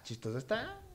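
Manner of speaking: playful repeat. Shown as a demonstration. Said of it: "Ah, chistoso está".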